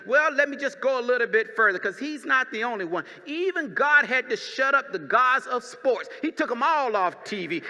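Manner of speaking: loud, fervent pulpit preaching. Acted. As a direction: preach it out full force to the church. Pastor preaching to congregation.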